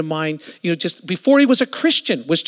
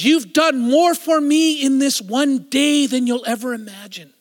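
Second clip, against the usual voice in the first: impassioned retelling; 8 semitones higher, 4 dB louder than usual. Retold or imitated. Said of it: "He goes".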